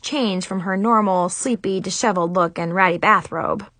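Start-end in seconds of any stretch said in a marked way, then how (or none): none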